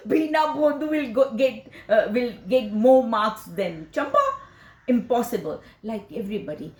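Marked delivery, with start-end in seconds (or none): 0.1-4.2 high, louder mocking words half laughed; laughing taunt, loud and high; teasing through laughter. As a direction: mimic relatives laughing at her.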